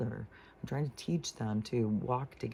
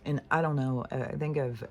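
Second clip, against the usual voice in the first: ordinary talk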